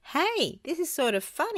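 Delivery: high, delighted cry; thrilled on a bucking bronco; excited cry. A narrator's reading. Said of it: "he cried".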